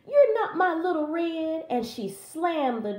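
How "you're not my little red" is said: high, loud indignant cry; shrieked accusation; yelling Granny. Acted. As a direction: yell it as an alarmed granny. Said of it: "yelled Granny".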